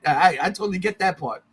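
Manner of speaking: ordinary delivery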